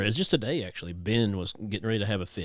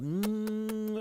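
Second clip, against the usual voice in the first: put-on baby kiss hum.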